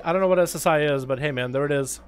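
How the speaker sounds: normal talking voice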